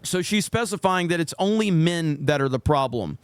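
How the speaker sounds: ordinary talk, nothing marked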